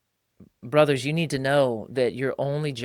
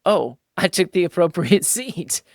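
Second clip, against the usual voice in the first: mock humble; 5 semitones higher, 8 dB louder than usual. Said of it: "you go".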